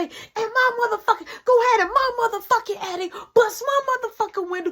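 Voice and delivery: high, fast rant; a mother going off about her broken window; mama ranting. Retold or imitated.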